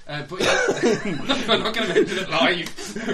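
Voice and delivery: laughing through the words; giggling, loud. Real, not acted. Laughing while teasing.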